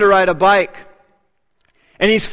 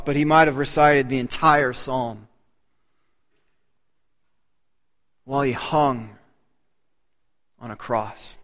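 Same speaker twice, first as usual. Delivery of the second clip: softer and lower, fading to almost nothing; hushed solemn fade; 7 semitones lower, 6 dB quieter than usual. Real, not acted. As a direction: let it sink to a hush. Solemn point about the crucifixion.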